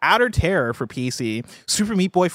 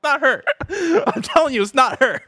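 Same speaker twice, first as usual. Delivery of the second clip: crying with laughter; laughing hard; 11 semitones higher than usual. Real, not acted. Helpless laughter.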